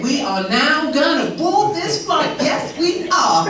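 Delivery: high, loud, slow and proud boarding call; proud American gate agent. Retold or imitated. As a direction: announce boarding like it's a celebration. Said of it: "You guys are amazing at this stuff".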